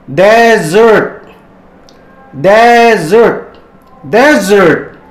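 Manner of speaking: Desert said DEZ-ert, stress on the first syllable; model pronunciation. Shown as a demonstration. Teacher models Desert for students, stress on DEZ. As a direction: model Desert slowly for the class to repeat. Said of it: "kita ulangi lagi ya".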